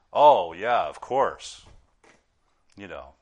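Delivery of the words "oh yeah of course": loud, mocking "oh yeah, of course"; sarcastic. Pastor mocks his kids' sarcasm, loud sarcastic jab. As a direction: drip with fake agreement. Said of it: "if you say a sarcastic sentence like".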